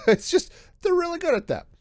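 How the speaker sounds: real chuckle rising into high-pitched words; laughing. Real, not acted. Joking that rocks stop bullets well, laughing.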